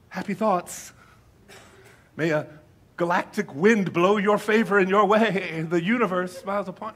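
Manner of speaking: put-on new-age blessing voice; mock well-wisher. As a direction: send up a vague cosmic blessing. Mocks non-believers' well-wishing phrases.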